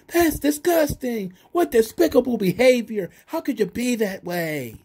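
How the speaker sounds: high, whiny mock-outraged announcer; terrible commentator. Commentator scolding Daivari for wearing the vest, high mock outrage. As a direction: squeal it out like a bad wrestling commentator scolding Daivari. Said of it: "He just kept saying".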